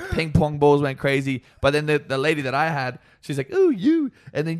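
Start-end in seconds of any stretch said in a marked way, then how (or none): 3.5-4.1 high-pitched, louder coo of selection; imitated flirty woman. Stage performer choosing him from the crowd, imitated flirty call. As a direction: mimic a performer picking someone out. Voicing the lady picking him out.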